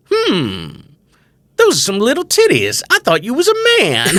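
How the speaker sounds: high, loud, comic exclamation; blunt stranger. Retold or imitated. Man walking up to a comedian in the street, loudly baffled. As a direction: blurt it out loudly in mock disbelief.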